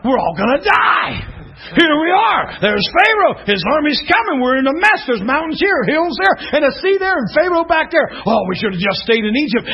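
hammed-up alarmed wailing; panicked complaining. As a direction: play a crowd panicking at being trapped. Panicked Israelites trapped at Red Sea.

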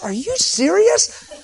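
high, slow incredulous question; a stunned mom can't believe the offer; surprised disbelief. Retold or imitated. Mother on the plane asks in high, slow disbelief.

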